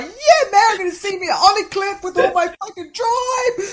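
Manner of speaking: high, loud, laughing hype; mock excitement. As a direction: pump yourself up, hammed up, half laughing. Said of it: "I was like".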